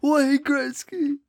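drawn-out, high, hyped name call; hype announcer call. Pretend. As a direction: announce him like a star entrance. Hype announcing Gretzky's arrival.

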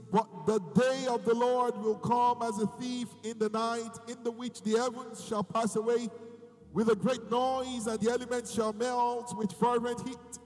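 preaching at full force. Real, not acted.

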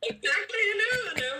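high, loud laughing burst of agreement. Real, not acted. Host laughing loudly in agreement with her guest. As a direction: laugh out a shout of yes.

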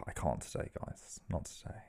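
very quiet, sighing near-whisper; sigh, then let the words sink away; dejected refusal. Acted. Voicing dejected Mister Tickle refusing friends.

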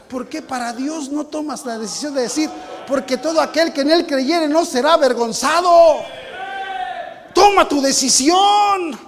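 loud, high, slow preacher's cry; fiery sermon appeal, shouting to the congregation; preaching at full force. Acted. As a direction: press the call to decide on the whole church. Preaching the call to decision.

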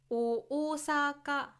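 「大阪」を東京式に、オは低くオーサカと後を高く; 標準語の大阪. Shown as a demonstration. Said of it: "普通の標準語ですね。". (標準語アクセントでゆっくり「大阪」)

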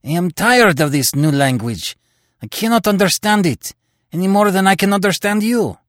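Terry pouring out his frustration at his captors; passionate complaint. Acted. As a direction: vent the frustration, voice climbing high. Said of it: "cried Terry passionately".

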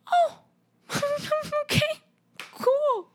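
tiny, very high voice; stunned little reaction. Retold or imitated. Reacting to Lou calmly leaving, tiny high voice. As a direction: squeak it out weakly.

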